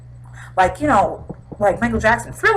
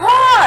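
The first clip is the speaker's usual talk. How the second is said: startled scream; 8 dB louder than usual. Retold or imitated. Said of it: "And he's like".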